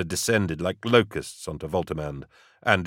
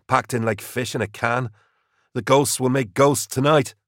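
lightly voiced wounded soldier, lifting at the end; a hint of a dying man's last words; understated trooper's character voice; 5 semitones higher than usual. A narrator's reading. Dying trooper speaks to commissar.

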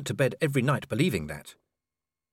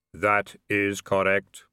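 slow, low, otherworldly Death; drawn-out reaper musing; grim reaper voice; 3 semitones lower, much slower than usual. Acted. Death speaking.

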